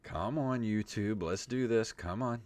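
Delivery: usual speaking voice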